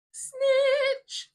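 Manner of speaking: high loud drawn-out snitch; shouted punchline. Real, not acted. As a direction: belt out the word snitch.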